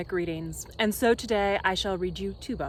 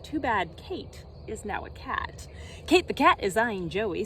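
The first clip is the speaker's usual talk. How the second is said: usual speaking voice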